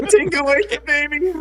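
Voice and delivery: high-pitched mimicry of a failed Australian accent; mock bad Australian. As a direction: tease with a hammed-up fake Australian accent. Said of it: "That's not Australian".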